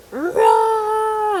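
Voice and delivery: loud, high mock dinosaur roar; hammed-up farewell roar; playful roar.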